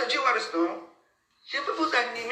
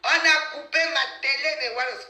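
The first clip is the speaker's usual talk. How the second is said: loud, higher complaint; indignant outburst; 7 semitones higher, 6 dB louder than usual. Real, not acted. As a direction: protest loudly to the viewers. Ranting to viewers about cut TV.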